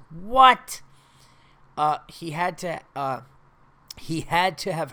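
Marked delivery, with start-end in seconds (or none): 0.1-0.8 loud, high, disbelieving "what"; incredulous outburst. Real, not acted. Host reacting to a coworker's absurd line, incredulous outburst. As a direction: blurt it out in disbelief.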